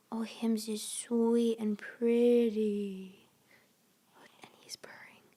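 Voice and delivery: soft whisper so the purr is heard. Real, not acted. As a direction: whisper beside the purring cat. Whispering near purring cat.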